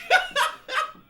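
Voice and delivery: squealing laugh; high shriek of laughter. Real, not acted. Laughing at the umpire's call, a high squeal. Ump's strange strike call.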